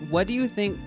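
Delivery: usual speaking voice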